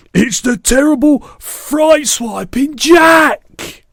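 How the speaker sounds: high, slow, wailing giant bellow; panicked bellow. A narrator's reading. Giant bellows as dream Jack attacks him, terrified wail. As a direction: howl each blow out at full volume. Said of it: "bellowed the flesh lump eater".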